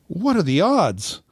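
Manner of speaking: high, swooping mock astonishment; feigned amazement. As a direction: marvel at it with hammed-up wonder. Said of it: "And I go".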